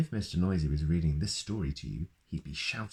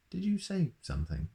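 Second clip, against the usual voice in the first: mock innocent; 12 semitones higher than usual. Butcher feigns deafness.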